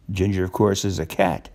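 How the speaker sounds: ordinary delivery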